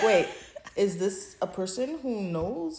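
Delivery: usual speaking voice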